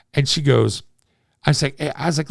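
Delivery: usual speaking voice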